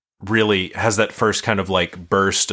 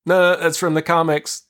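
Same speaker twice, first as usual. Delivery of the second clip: owning the nerdiness; 9 semitones higher than usual. Retold or imitated. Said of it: "own it and be like".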